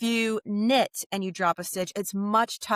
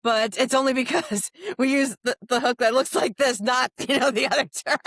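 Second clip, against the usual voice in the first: raised, giggly disclaimer; laughing explanation; 5 semitones higher, 4 dB louder than usual. Real, not acted. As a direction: explain it through embarrassed laughter. Laughingly explains a risqué nickname.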